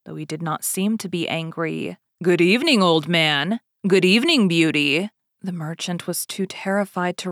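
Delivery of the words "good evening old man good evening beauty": louder, booming Beast voice; a read-aloud Beast, loud and imposing; Beast's greeting. Acted. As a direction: greet them like a looming beast. Beast greets his guests.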